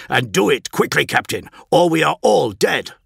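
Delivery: loud, high, urgent command; barked warning. A narrator's reading. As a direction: bark it out gravely, all levity gone. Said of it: "barked Thematica, all levity gone from his voice".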